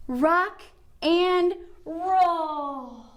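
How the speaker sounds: very slow, drawn out "Rock and roll". Shown as a demonstration. Teacher leading kids through the rocking pose, very slow. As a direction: stretch every word of "Rock and roll" out long.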